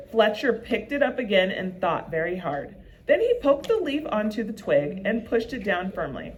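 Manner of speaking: plain reading voice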